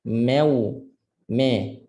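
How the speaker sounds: "meu" with e in place of a; wrong me vowel. Shown as a demonstration. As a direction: front the vowel into "meu", then "me". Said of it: "tak nak jadi me". ("meu" dengan ma jadi me)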